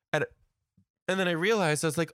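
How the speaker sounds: normal talking voice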